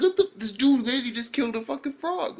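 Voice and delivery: high-pitched outrage; can't believe what he saw; disbelief. Real, not acted. Reacting in disbelief.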